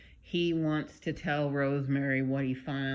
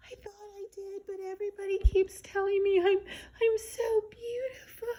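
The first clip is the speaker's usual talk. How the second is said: put-on very high, whimpering childlike voice; little baby voice. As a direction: whine it like a hurt little girl. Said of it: "her little baby voice".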